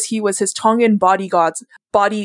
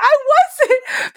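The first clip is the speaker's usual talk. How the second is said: laughing denial. Retold or imitated. Denying it while laughing.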